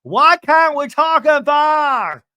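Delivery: high, loud, very slow mock outburst; host re-does Lisa's outburst, slow, high and loud; exploding shout. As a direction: hammer each word like a furious blowup. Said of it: "how she explodes".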